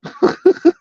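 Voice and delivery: sharp high laugh; high mocking laugh. Real, not acted. Mocking laugh after callout.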